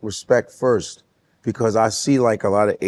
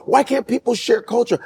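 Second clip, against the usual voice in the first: mocking quote; 11 semitones higher than usual. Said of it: "they go".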